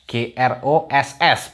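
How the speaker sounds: letters G R O S S spelled slowly; slow spelling. Shown as a demonstration. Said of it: "spelling demo". (mengeja huruf satu per satu)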